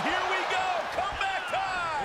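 high, eager comeback call; rally the crowd as the comeback starts; excited. Real, not acted.

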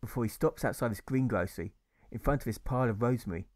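usual speaking voice

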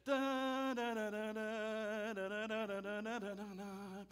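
soft, monotone da da da of a smooth preacher; mock smooth preacher. Mocking a smooth-talking preacher, soft monotone drone. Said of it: "He doesn't raise his voice like me".

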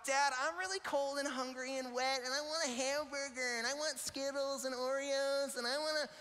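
put-on high whining child voice; whiny kid. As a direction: whine like a tired, hungry kid. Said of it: "You know what I'm talking about?".

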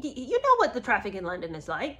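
ordinary talk, nothing marked

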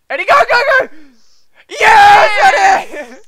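very high, loud cheer; ecstatic cheering. Real, not acted. Cheering his friend on to his first goal, ecstatic yells. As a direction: scream with joy as your friend scores.

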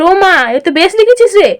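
lilting, pat-on-the-back tone; patronizing praise. Acted. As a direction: praise them like an indulgent elder, then tease. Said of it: "বেশ পিঠ চাপড়ানো সুরে বলল".

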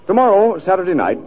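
usual speaking voice

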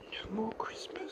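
breathy whisper, voice gone. Real, not acted. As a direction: whisper it sadly to yourself. Sad about taking decorations down.

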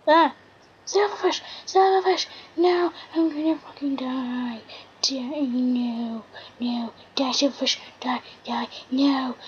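fighting off pests in a panic; real panic at silverfish; panicked. Real, not acted.

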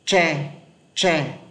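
"Tre" as isolated Vietnamese word, retroflex tr. Shown as a demonstration. Teacher shows students the Vietnamese comparison sound, clearly demonstrated "Tre". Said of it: "phát âm từ chữ tre này trong tiếng Việt".

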